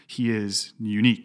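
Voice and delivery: ordinary delivery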